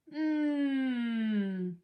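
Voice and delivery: long, sinking "mmm" of pleasure; savouring hum. A narrator's reading. Dog has just chomped a treat, savouring hum. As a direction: hum with relish over a mouthful.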